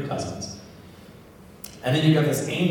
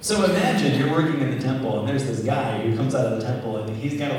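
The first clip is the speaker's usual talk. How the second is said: normal talking voice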